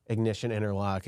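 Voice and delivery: ordinary delivery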